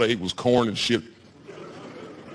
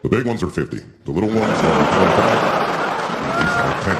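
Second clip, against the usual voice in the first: low chief voice; 7 semitones lower, 5 dB louder than usual. Retold or imitated. Chief quoting weed prices in a low voice. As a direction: sell it like a stoic chief.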